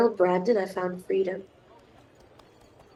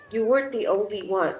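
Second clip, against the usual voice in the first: normal talking voice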